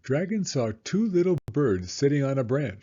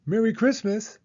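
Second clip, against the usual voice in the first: higher, bright festive call; cheerful greeting; 9 semitones higher than usual. Acted. Dragon greeting the hungry raccoons, cheerful and high. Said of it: "said Dragon".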